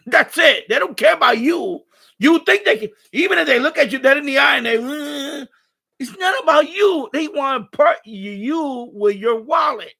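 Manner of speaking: loud, heated rant with rising pitch. Real, not acted. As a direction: shout it out, worked up. Ranting about strip club staff.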